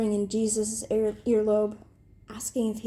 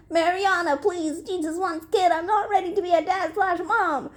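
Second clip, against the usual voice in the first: high-pitched desperate plea; frantic, high voice begging for help; panicked yell; 8 semitones higher, 3 dB louder than usual. A narrator's reading. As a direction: cry out in a panic. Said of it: "completely panicked, yells out".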